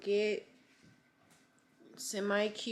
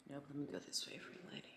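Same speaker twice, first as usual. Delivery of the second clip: whispering; 5 semitones lower, 14 dB quieter, much faster than usual. Real, not acted. Aside while adjusting camera.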